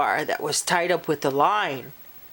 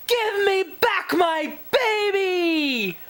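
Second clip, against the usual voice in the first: much higher, slow desperate shout; 11 semitones higher than usual. Acted. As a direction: scream down the hole for your child. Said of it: "father yelled down the hole".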